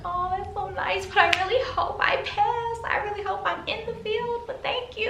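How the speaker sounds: high, teary, gushing thanks; high nervous gratitude to the guard. Retold or imitated. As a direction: thank him while nearly crying from nerves.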